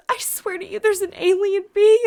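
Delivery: high, rising retold panic; freaking out. Telling the neighbor about an alien being, freaking out. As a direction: insist in a loud, high-pitched panic. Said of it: "I'm freaking out".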